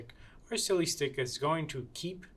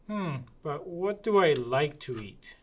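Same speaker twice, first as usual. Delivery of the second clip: ordinary delivery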